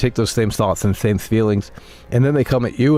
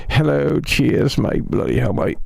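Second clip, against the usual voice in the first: higher, cheery mock British accent; 5 semitones higher, slower than usual. Streamer parodying British callers, in a mock British accent. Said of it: "why do you hate British people?".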